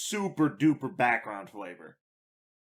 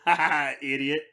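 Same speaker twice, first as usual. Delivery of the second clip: ordinary talk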